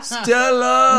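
loud, high yell of Stella. Retold or imitated. Friends riffing on a film, yelled Stella. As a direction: bellow it like Brando in the street.

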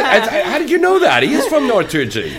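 high, laughing, caught off guard; laughing surprise. Real, not acted. Host surprised by correct guess.

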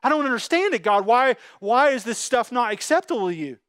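high, loud whiny mock complaint; mocking complainer. God mimicking complaining people, high loud mock whine. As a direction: whine at God like a spoiled worshipper. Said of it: "And you snort at it".

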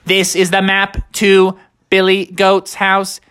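high, even robotic voice; robotic monotone with high beeps; Techno Bear. Acted. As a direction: announce it like a computer, then beep. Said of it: "Techno Bear actually pulled it up".

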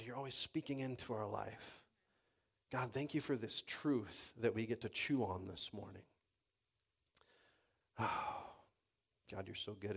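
low, soft, sincere prayer; hushed pastoral prayer. Real, not acted. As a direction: pray quietly to God, with pauses. Leads the congregation in prayer.

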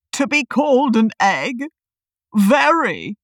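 affronted, emphatic character voice; Humpty Dumpty. A narrator's reading. Humpty objects to being called an egg, affronted. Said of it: "Humpty Dumpty said".

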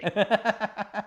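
warm amused laugh. Real, not acted. Laughing about the crowd pointing at him. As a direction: laugh at the memory.

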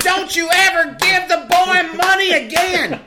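high, hammed-up furious shout; mock dad yelling. Dad screaming at mom over the money, hammed-up high shout. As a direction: bellow it like a cartoon angry father.

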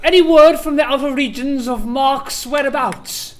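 loud regal demand; pompous king. Acted. King questions servants.

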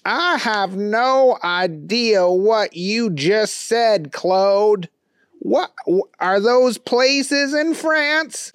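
high, slow, baffled; high dopey puzzlement; Booger voice. A narrator's reading. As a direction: drag it out in confusion. Booger baffled by French dishes.

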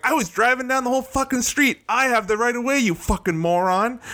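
high heated retold rant. Telling off the other driver, heated mock rant. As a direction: replay the shouting match, heated. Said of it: "I'm like".

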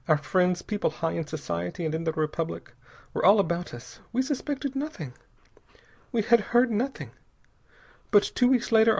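high, anguished woman recounting horror; despairing young wife. A narrator's reading. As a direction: recount it haltingly, pitched high. Narrator voices the girl's account.

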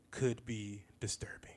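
slow hushed newscaster warning; mock anchor, slowed down and hushed; mock news warning. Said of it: "as they say on M S N B C".

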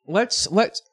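coughing, choking mid-word; words caught in a cough; choking on a drink. Real, not acted. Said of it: "you're choking right now".

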